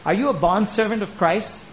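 normal talking voice

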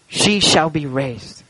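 a grieving father's quiet certainty; weeping father. A narrator's reading. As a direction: let the grief settle as the line drops. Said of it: "he began to weep bitterly".

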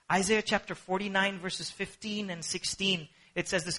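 ordinary delivery

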